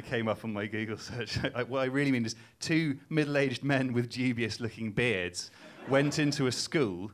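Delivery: usual speaking voice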